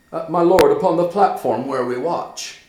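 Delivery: loud, earnest report; loud reply. Acted. Horatio telling Hamlet where, loud and earnest. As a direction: answer straight.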